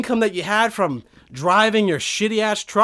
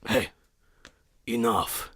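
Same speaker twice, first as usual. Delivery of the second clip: low, quiet, final warning; Viking voice; 9 semitones lower, 9 dB quieter, slower than usual. Pretend. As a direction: shut him down with a deep growl. Viking cuts him off.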